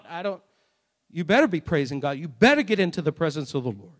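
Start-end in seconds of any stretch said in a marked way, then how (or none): none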